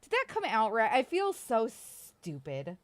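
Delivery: ordinary delivery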